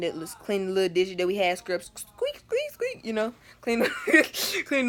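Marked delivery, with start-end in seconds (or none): 1.8-3.0 high, soft squeaks; squeaky scrubbing noise. Retold or imitated. Scrubbing the little dishes, high squeaky sound effect. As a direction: squeak like a sponge on a plate.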